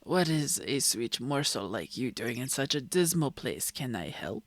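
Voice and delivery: low, smooth ladykiller voice; self-assured, teasing low voice; suave flirt. Acted. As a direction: purr it like a vain charmer. Voices Gavin in the game.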